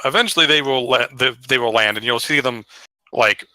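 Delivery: ordinary talk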